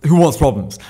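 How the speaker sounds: mock tough answer. Answering the phone, acting the tough guy. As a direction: answer like you own the place. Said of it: "I was like".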